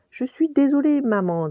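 high little-rabbit apology; petit lapin penaud. A narrator's reading. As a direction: apologize in a high child's voice, falling away. Pampan grondé s'excuse auprès de sa mère. (petite voix aiguë d'excuse)

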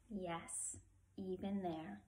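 hushed, gentle mother's answer; mother soothing her twins; soft reassurance. A narrator's reading. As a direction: say it softly to comfort.

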